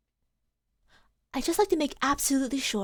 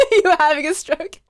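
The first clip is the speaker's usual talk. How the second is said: laughing through the words; 10 semitones higher, 7 dB louder than usual. Real, not acted. Player laughs at the clunky lawyer line, laughing. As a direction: crack up mid-sentence.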